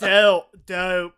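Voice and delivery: mock cartoon voice; catchphrase impression. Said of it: "He says".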